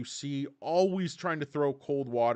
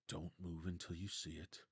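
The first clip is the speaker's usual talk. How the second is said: low, much softer murmur; hushed coach; 8 semitones lower, 16 dB quieter than usual. Retold or imitated. Coach urging the boy to wait, hushed. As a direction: coax him gently under your breath. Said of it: "It's like".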